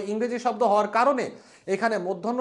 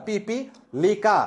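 slow model word; much slower than usual. Shown as a demonstration. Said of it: "রস্যিকার, দীর্ঘিকার".

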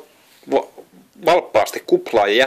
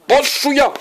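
hollering. A narrator's reading. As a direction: bellow the alarm. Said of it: "Joni kailotti".

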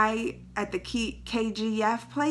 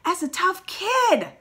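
slow, higher mock tough-kid voice; 8 semitones higher, slower than usual. Shown as a demonstration. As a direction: play the tough kid, drawn out. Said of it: "repeats phrase in different voice".

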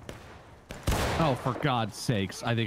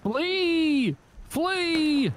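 battle cry. Pretend. Gamer yelling at his squad to flee, high battle cry. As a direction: yell the retreat to the squad. Soldiers retreating in battle game.

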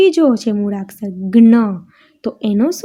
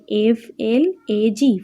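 letters spelt out slowly one by one; slow letter-by-letter spelling; much slower than usual. Shown as a demonstration. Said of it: "એમાં પણ ત્રણ spelling છે".

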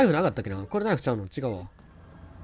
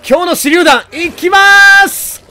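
high, loud, drawn-out call; slow stretched loud announcement; hammed announcement; 12 semitones higher, 9 dB louder, much slower than usual. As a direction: call the throw like a showman. Announcing grenade throw.

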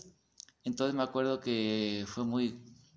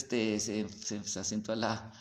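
usual speaking voice

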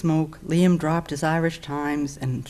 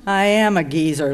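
loud, mildly growled comeback; touch of gruff indignation, loud; growled retort; 3 semitones higher, 8 dB louder than usual. A narrator's reading. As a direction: growl it back, voice raised. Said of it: "Liam growled".

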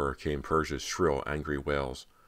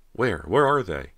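panicked; 5 semitones higher, 7 dB louder than usual. A narrator's reading. Cody panicking about rats.

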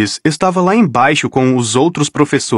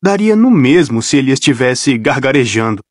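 usual speaking voice

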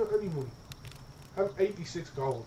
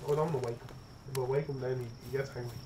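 usual speaking voice

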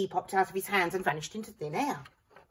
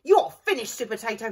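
higher, louder villain shriek; 4 semitones higher, 8 dB louder than usual. A narrator's reading. Evil pea shrieking at Sue Potato. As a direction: screech a threat. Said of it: "he shrieked".

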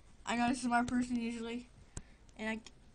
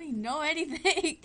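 laughing; 4 semitones higher, 6 dB louder than usual. Real, not acted. Laughing at herself.

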